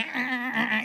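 quick, soft little gosling honks; imitated gosling honks. Gosling answering its mother and running, soft quick honks. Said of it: "y el gansito hace".

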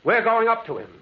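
high, loud shout across distance; a man shouting from the tracks for a lantern; calling out. Acted. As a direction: call out urgently to McGregor.

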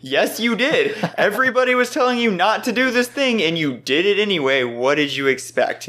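high, exasperated telling-off; exasperated scolding. Retold or imitated. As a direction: scold him in disbelief. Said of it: "And I was really going".

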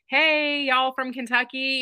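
a hint of a friendly Southern host calling out; Southern greeting. Event owner greeting the Kentucky couple, lightly Southern. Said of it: "the owner of the event was like".

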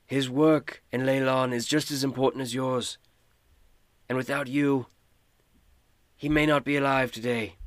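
lightly voiced lord reading a formal letter; a hint of a gracious noble dictating praise; Lord Neverember's voice. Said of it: "do his voice".

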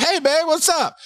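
high, chirpy hello to a partner; cheerful phone greeting. Retold or imitated. Cheerfully answers wife's phone call.

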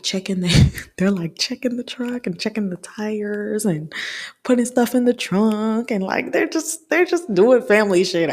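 high, laughing rush through "checking the truck". Real, not acted. Laughing while describing a family.

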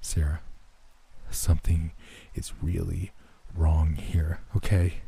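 hushed, halting plea in short pieces; near-whisper. A narrator's reading. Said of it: "I kept my voice at a whisper".

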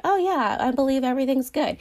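high, quick, carefree mock breeziness; breezy complacent. Believer coasting along on easy faith, breezy and high. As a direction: toss it off as if nothing could go wrong. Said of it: "you're just kind of like".

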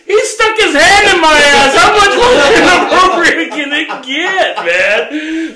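high, shouted comic outrage; loud exasperated retort, voice soaring; outraged comeback. Retold or imitated. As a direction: yell it at the top of the range, incredulous. Outraged comeback to sister's excuse.

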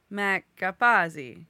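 name in a loud Italian accent. Shown as a demonstration. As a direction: put an Italian accent on Capazzi. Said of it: "do the accent every time".

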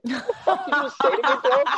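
high, fast, mock-offended. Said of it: "And I was like".

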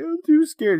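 I'm too scared in a high, whiny fake-crying child voice; fake crying kid. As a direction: whine it like a kid faking tears. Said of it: "fake crying about it".

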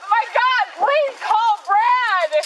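high, loud distressed protests; upset shouting. Real, not acted. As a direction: plead and accuse at once. Distressed during arrest.

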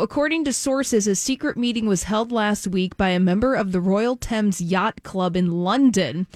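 normal talking voice

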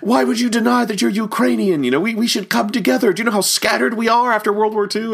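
high-pitched, indignant mock protest; imitating offended man. Ukrainian man confronts Paul Frees over denied heritage, mock indignant. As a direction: act out taking it personally, voice pushed way up. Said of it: "took it personally".

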